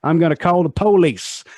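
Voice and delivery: mock Southern US accent, front-stressed "police". Shown as a demonstration. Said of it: "how they say it in a lot of Southern".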